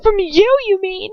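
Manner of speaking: high, loud defiant retort; defiant scream. A narrator's reading. Captive screaming back at Bellatrix from the floor, defiant. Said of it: "I scream on the floor".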